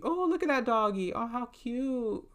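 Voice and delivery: high, drawn-out coo; real high-pitched aww voice; cooing at a dog. Real, not acted. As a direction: gush over a cute puppy. Distracted by a dog outside window.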